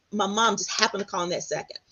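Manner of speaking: ordinary delivery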